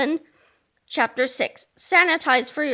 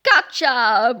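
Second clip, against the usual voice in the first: low, forceful villain cry; deep triumphant pounce; roaring monster; 4 semitones lower, 4 dB louder than usual. Acted. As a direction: roar it in triumph. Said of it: "roared the loathsome lavatory".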